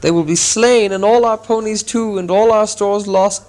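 high, loud lament; the dwarves moaning in despair. Acted. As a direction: wail over the losses. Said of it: "moaned the others".

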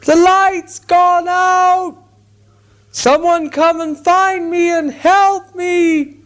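very high, loud and slow panicked calling; slow, high, loud calls of fear in the dark; frightened cry for help. Acted. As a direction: courage gone, wail for help. Lost hobbit calls for help.

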